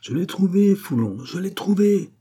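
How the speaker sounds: high-pitched excited announcement; crying out. A narrator's reading. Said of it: "tout essoufflé en criant".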